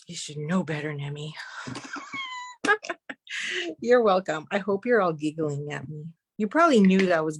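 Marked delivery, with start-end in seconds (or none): 1.4-3.7 very high, squeaky giggle; long high giggle, almost crying; helpless laugh. Real, not acted. Laughs at her own mishap.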